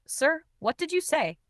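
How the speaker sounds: high, thin-edged question in shock; voice cracking. A narrator's reading. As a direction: ask him with a touch of a cracking voice. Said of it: "My voice cracked".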